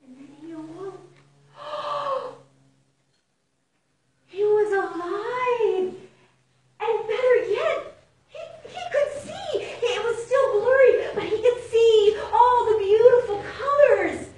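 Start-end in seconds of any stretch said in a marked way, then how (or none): 1.6-2.4 sudden gasp of surprise, pitched high; sharp high gasp. A narrator's reading. Narrator as Mouse wakes, a high surprised gasp. 4.3-14.3 loud, high, laughing narration breaking with emotion; joyful excitement. A narrator's reading. Narrator telling children Mouse can see, loud high joy.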